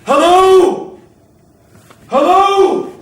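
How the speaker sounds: loud, high searching shout; calling out. Acted.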